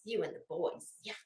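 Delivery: normal talking voice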